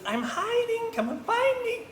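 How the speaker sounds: very high, squeaky singsong; teasing tiny mouse in hide-and-seek; little girl mouse. Acted. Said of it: "She goes".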